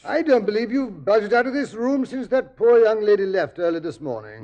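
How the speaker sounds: loud, high-pitched reproach; reproachful exclamation. Acted. Friend scolds Holmes for never leaving the room, reproachful.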